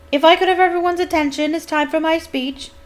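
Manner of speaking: loud, high, brisk call for attention; loud announcement. A narrator's reading. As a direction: address a noisy crowd over the din. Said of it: "said Nearly Headless Nick loudly".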